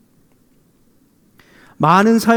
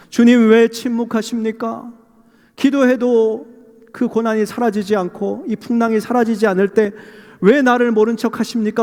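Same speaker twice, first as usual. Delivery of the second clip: high, sustained complaint to God; plaintive prayer; 4 semitones higher than usual. Acted. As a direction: plead and protest in prayer. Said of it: "하나님 앞에 늘 기도하면서".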